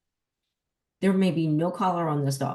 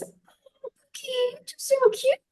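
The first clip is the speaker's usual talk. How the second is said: much higher, cooing dog-owner voice; high girly baby talk; 5 dB louder than usual. Retold or imitated. Trainer mocking owners who baby-talk their dogs, high girly coo. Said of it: "in a high girly voice".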